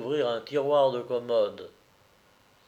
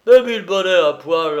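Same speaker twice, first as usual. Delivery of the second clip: loud, raised military oath; roaring outburst; 6 semitones higher, 13 dB louder than usual. Acted. Drum major seeing he was fooled, roaring. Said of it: "rugit le tambour-major".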